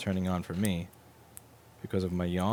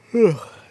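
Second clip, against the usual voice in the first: mock sigh; 12 semitones higher, 10 dB louder than usual. Laptop's mock reply.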